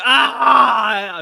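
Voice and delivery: high, loud cry of "ah"; loud drawn-out scream. Pretend. Streamer reacting to the screen, screaming. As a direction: scream it out long.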